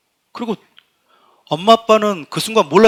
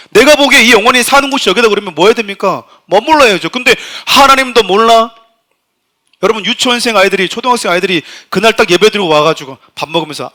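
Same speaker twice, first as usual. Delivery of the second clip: 크게 힘주어 설교하듯; 목소리를 높여 회중에게 강하게 역설; 4 semitones higher, 9 dB louder than usual. Real, not acted. (힘 있게 외치는 설교조)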